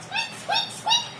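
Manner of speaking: very high, tiny squeaks; little mouse squeak. A narrator's reading. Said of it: "gave a little mouse squeak".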